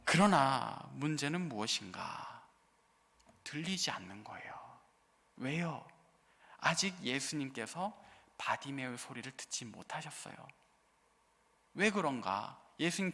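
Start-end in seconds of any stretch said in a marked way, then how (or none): 0.0-10.3 whisper. Real, not acted. Dramatic hush in sermon.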